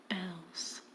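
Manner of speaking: whispered end; hushed final word. Acted. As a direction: let it die to a breath. Dropped to a whisper.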